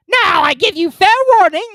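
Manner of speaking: high, loud royal bellow; furious shout. A narrator's reading. Queen threatening the Duchess, shouting in fury. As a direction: roar a warning at the Duchess. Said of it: "shouted the Queen".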